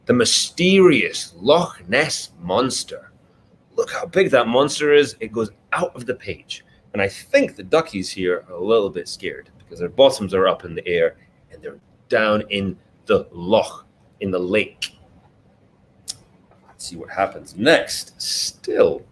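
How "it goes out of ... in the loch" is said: ordinary delivery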